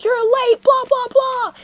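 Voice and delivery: high, mocking dad's scolding; mock angry dad. Retelling the abusive dad yelling, high mocking tone. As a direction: parrot the dad dismissively.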